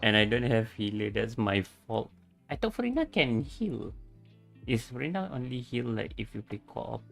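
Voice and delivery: usual speaking voice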